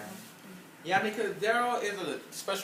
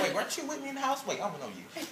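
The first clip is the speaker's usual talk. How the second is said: high, quick feigned puzzlement, dropping away softer; mock non-recognition; 4 semitones higher, 6 dB quieter than usual. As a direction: act like you can't place them. Mock snub of C J, acted out.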